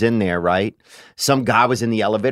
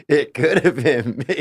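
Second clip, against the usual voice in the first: laughing; 4 semitones higher than usual. Real, not acted.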